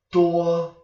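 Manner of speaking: high level tone on "Duo"; first tone. Shown as a demonstration. Teacher showing viewers the tone combination, first tone. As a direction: hold "Duo" high and steady without a bend. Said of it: "Duo is a first tone".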